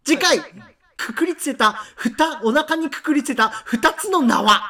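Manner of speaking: anime episode preview. Acted. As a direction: hype up the next episode like an anime. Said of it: "綱引き少年ヒパタの次回予告".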